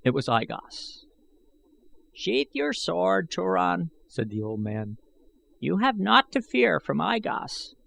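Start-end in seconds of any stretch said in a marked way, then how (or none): 2.1-3.9 put-on high, slow, cracked old man's voice; an ancient schemer speaking up; old man I Gos. A narrator's reading. Old man warning Gahan from the shadows, high and aged. 5.6-7.8 an ancient schemer speaking up; old man I Gos. A narrator's reading. Old man warning Gahan from the shadows, high and aged.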